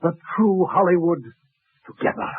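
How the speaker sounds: swept up, rising to a high, grand plea; carried away romance. Acted. Butler overplays wooing Hester, carried away. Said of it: "I got carried away".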